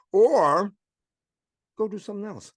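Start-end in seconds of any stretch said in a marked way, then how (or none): none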